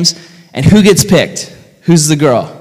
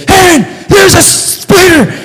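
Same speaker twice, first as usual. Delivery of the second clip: high, drawn-out imitation shriek; mock panicked scream; 4 dB louder, slower than usual. Wife waking her husband over a spider, mock panicked scream. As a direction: act out a wife's alarm, shouted high and slow. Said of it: "my wife woke me up and said".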